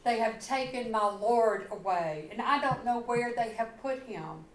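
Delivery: normal talking voice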